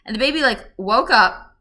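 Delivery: ordinary talk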